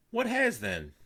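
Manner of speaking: put-on high, quavery old lady; Granny. A narrator's reading. Granny answering while spreading jam, high. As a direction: ask absently.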